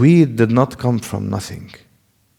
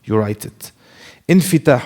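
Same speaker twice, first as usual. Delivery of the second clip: normal talking voice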